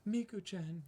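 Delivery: anime gasp line. Retold or imitated. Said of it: "have a character be like".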